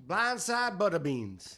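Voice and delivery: slow, drawn-out Southern country voice; country accent. Pretend. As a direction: drawl blindside butter beans like a country bumpkin. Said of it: "Everybody do your best country voice".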